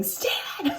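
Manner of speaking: high-pitched mock yell of a name; retold yell. Panicking shopper yelling for Stan over lost paint, mock yell. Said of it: "I'm yelling".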